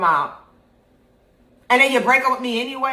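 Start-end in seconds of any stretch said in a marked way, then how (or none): 1.7-2.9 high, fast, loud rant at the ex; bitter outburst. Retold or imitated. Venting at the ex who left her, fast, loud and bitter. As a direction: spit it out, fed up.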